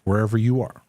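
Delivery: usual speaking voice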